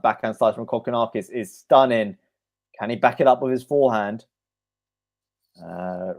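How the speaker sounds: quick running tennis commentary; a light commentator's play-by-play; live play-by-play. Acted. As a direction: call each shot as it happens.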